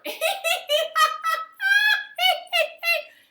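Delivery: high, loud helpless laughing; hard laughter. Acted. Princess laughing so hard, high helpless laughter. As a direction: laugh till you can't stop. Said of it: "She was laughing so hard".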